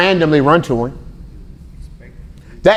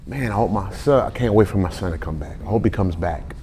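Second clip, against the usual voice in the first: longing father; 5 semitones lower, 8 dB quieter than usual. Retold or imitated. Father hoping son returns.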